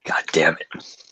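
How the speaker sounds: hushed exasperated whisper; whispered curse. Real, not acted. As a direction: breathe it out in defeat. Groans at double SAO news.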